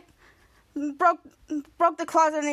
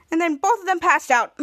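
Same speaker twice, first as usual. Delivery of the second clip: ordinary delivery, nothing marked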